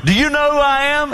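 high, haughty demand from the emperor; imperious emperor. Retold or imitated. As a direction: play the arrogant Roman emperor. Emperor voiced in retold story.

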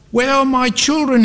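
high-pitched desperate plea; pleading. A narrator's reading. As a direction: plead upward, searching for the children. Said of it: "he pleaded".

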